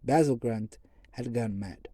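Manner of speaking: plain reading voice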